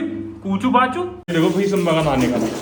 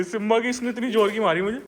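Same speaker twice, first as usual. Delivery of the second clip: ordinary delivery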